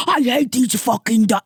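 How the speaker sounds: exaggerated furious rant; mock angry cursing. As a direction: pretend to curse someone out. Continues the pretend cursing outburst.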